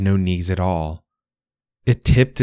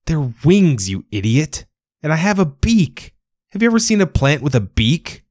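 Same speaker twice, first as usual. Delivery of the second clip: higher and sharper, insulted; indignant scold; 9 semitones higher than usual. A narrator's reading. Penguin scolding the plant who called its wings leaves, indignant. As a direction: tell the fool off.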